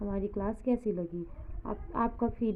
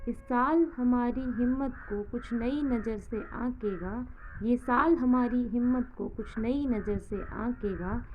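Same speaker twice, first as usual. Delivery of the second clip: quote recitation; 6 dB louder than usual. Acted.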